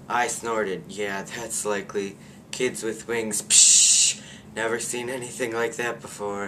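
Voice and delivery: exaggerated sarcastic reread with a loud "psh"; "Kids with wings, psh!" in a hammed-up scoffing voice, "psh" spat out loud; hammed-up parody. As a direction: overplay the sarcasm to mock the line. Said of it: "Of course she didn't say that, exactly".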